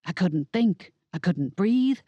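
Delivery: ordinary delivery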